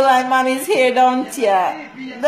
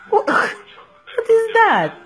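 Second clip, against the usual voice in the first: laughing; 6 semitones higher, much slower than usual. Real, not acted. Mom laughing at baby's face.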